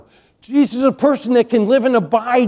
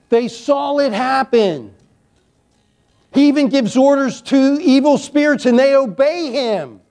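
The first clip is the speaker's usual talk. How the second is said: usual speaking voice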